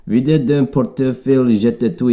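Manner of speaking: a French-speaking mugger threatens a tourist, hammed-up French. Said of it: "mugged by a French speaker".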